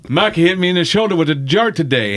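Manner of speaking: high, loud tattletale voice; put-on high, insistent tattling; tattling brother. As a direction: imitate a brother loudly telling on you. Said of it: "He goes".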